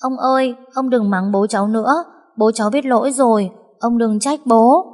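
put-on high child's voice, coaxing grandpa; little girl pleading. Granddaughter begs grandpa not to scold her dad, high childlike pleading. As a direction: plead sweetly like a small girl. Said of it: "níu tay ông ngoại mà thủ thỉ".

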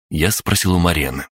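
usual speaking voice

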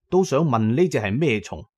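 usual speaking voice

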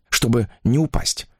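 ordinary delivery

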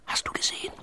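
urgent unvoiced whisper. A narrator's reading. Pitt whispering to Sven about Jule. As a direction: hiss the question under your breath. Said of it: "wisperte Pitt".

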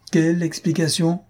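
mild angry yell. A narrator's reading. Husband refuses to hear any explanation, mildly yelling. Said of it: "Hurla notre homme".

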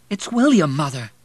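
high, loud start dropping away; anxious son. A narrator's reading. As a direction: correct mother with anxious urgency. Son urgently corrects delirious mother.

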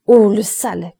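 dames outrées, nettement plus fort; cri scandalisé. Acted. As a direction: crie ton dégoût à Boche. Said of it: "crièrent les dames". (cri scandalisé des dames)

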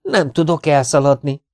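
high, loud helpless fright; petrified. A narrator's reading. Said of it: "Mert meg vagyok rémülve".

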